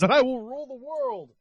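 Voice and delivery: rising to a shouted villain cry; megalomaniac rant. Acted. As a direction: gloat like a cartoon villain bent on conquest. Mock villain toy boast.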